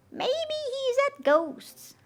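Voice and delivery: high, slow wondering witch; high slow musing witch voice. Acted. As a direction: muse it to yourself like a little witch. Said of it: "thought Little Witch".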